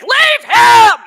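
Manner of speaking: the inner voice loses patience, shouting; inner voice yelling. Retold or imitated. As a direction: bark it like your gut screaming at you. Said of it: "Mine was like".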